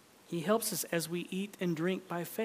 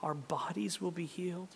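usual speaking voice

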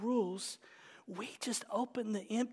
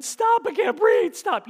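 mock laughing plea; 13 semitones higher, 14 dB louder than usual. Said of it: "where you're telling someone".

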